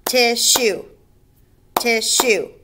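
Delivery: "Tissue" in two punched beats, tish, shoe; split into syllables. Shown as a demonstration. Teacher counting beats, "Tissue" syllable by syllable. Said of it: "or two beats".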